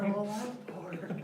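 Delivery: high, slow whiny echo; imitating Tom Segura. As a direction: echo the bit, high and drawn out. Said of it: "what he's talking about".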